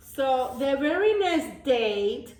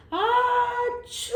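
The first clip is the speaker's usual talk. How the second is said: high achoo; sneeze; 12 semitones higher, 4 dB louder than usual. Acted. Kind deer shows the goat a sneeze, high achoo. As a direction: sneeze it out big. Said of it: "then I sneeze".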